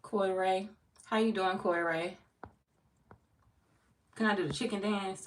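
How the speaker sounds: normal talking voice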